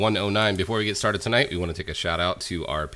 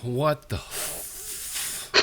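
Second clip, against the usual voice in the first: breathless whispered what the f; stunned disbelief; 7 semitones higher, 7 dB quieter, much slower than usual. Real, not acted. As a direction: caught off guard, the words barely get out, very slow. Stunned at gold spent.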